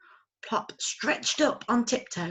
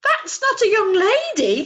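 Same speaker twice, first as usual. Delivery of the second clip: high, loud excited squeal; shriek; 13 dB louder than usual. Acted. Owlet shrieking at Father Christmas. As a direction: burst out in delight. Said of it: "shrieked Plop".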